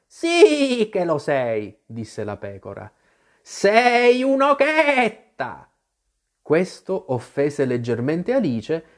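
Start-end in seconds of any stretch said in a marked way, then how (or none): 0.1-1.8 high, slow, drawn-out retort; contradicting sheep. Acted. The sheep contradicts Alice, drawn-out scolding retort. 3.4-5.7 high, slow, bleating-long vowels, loud; an exasperated sheep stretching out every stressed vowel; scolding sheep. Acted. The sheep scolds Alice for spinning round, high drawn-out scolding.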